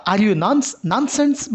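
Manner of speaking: "nonsense?" pitched high with a sharp rise; a high, incredulous rise on "nonsense"; rising intonation. Shown as a demonstration. Teacher modelling a scolding yes-no question. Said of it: "rising intonation বলতে পারি".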